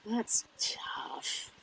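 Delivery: a private whisper while thinking it over; whispered to herself while searching for an answer; whispered aside. Real, not acted. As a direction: whisper it under your breath.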